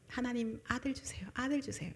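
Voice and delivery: soft, hushed pleading for a son; pleading prayer. Retold or imitated. Hannah's prayer voiced by preacher, quietly pleading. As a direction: beg quietly, over and over. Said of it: "기도 좀 있어요".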